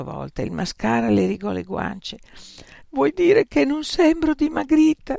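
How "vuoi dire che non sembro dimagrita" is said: high, tearful plea; sobbing. A narrator's reading. Frank crying to her friends, sobbing. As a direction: ask it through tears. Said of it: "singhiozzò Frank".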